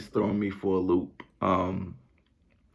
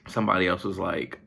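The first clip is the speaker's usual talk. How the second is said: ordinary talk, nothing marked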